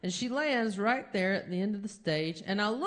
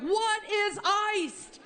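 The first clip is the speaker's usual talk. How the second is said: much higher, slow, confused question; baffled; 11 semitones higher, much slower than usual. Retold or imitated. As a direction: stretch it out in total disbelief. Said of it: "I'm like".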